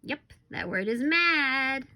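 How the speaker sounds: ordinary delivery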